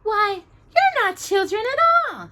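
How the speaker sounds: put-on high girlish surprise; surprised princess. A narrator's reading. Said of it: "stared in surprise".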